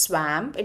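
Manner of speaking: "swamp" said as "swamp" rhyming with "camp"; wrong "swamp", with an "a" as in "stamp"; mispronounced swamp. Shown as a demonstration. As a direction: give "swamp" the short a of "cat". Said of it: "Please don't say it as".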